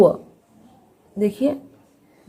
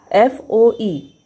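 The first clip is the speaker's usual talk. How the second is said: slow spelling; much slower than usual. Shown as a demonstration. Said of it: "spelling letters slowly".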